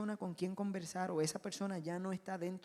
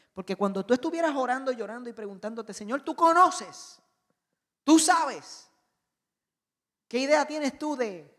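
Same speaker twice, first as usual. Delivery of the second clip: anguished prayer cry; 4 semitones higher, 11 dB louder than usual. Acted. Believer crying out to the Lord in prayer, loud and pleading. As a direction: cry out to God in despair.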